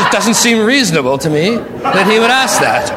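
chuckling through the words, amused; laughing. Real, not acted. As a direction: laugh it off while making the point. Laughs at Jesus' question.

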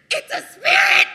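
shrieked high and loud in panic; terrified cry. Retold or imitated. Disciples seeing Jesus on the sea, terrified shriek. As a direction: scream it like a ghost just appeared. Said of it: "They were troubled, saying".

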